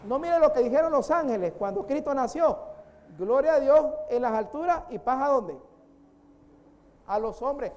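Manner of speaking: loud, high, stretched-out preaching; fiery pulpit voice; preaching at full force. Acted. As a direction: proclaim it to the congregation. Preacher proclaims Christmas message.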